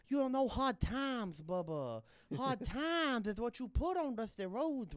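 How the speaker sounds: high, slow lisping wrestling promo drawl; Dusty Rhodes voice. Retold or imitated. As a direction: preach it slowly like a Southern wrestling star. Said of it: "You ever heard my Dusty Rhodes?".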